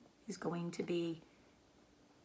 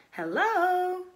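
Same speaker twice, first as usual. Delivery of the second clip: high, loud bleating hello; old sheep voice; 12 semitones higher, 7 dB louder than usual. Acted. Old sheep calling to Wilbur, high and loud. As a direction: greet like a nosy old sheep.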